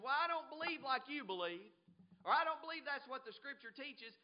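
loud, high dismissive retort; scoffing objection. Acted. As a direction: play the scoffer arguing back. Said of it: "He was scoffing".

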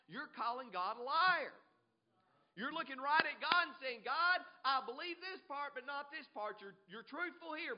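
high, loud accusing sermon; forceful preaching. Real, not acted. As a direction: charge the congregation with it.

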